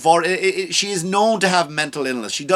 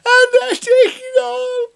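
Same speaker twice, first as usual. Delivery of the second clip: high, slow, wailing fake sob; 3 dB louder, much slower than usual. Comic mocking a celebrity's crying video, wailing high and slow. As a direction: ham up a teary celebrity breakdown.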